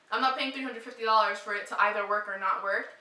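usual speaking voice

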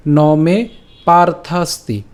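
"ন মে পার্থাস্তি" slowly, in separate pieces; broken-up verse. Shown as a demonstration. Teacher breaking the verse for learners, slow demo. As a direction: split "পার্থাস্তি" into its syllables. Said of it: "শ্লোকটি আমি ভেঙে দিয়েছি".

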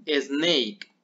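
Snake said as esneik, with an E sound before the S; extra E before snake. Shown as a demonstration. Teacher showing students the hidden E sound in snake. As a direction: put a short E before the S in Snake. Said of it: "pronunciar como una E que no está escrita".

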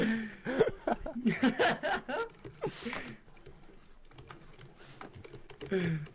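long high-pitched laugh; cracking up in a high squeal; high laughing fit. Real, not acted.

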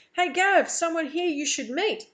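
high, loud hail to a friend; calling over shoulder. A narrator's reading. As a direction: holler back to your mate. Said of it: "He calls over his shoulder".

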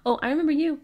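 usual speaking voice

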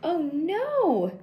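a storybook hint of panic; alarmed cry. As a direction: cry out as the kite falls. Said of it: "screamed Mole and Bear".

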